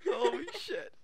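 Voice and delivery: high, laughing exclamation; laughing shock. Real, not acted. Reader laughing in shock at the text, high exclamation. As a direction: blurt it through laughter.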